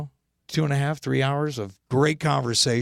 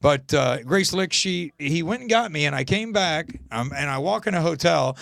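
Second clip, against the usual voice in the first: normal talking voice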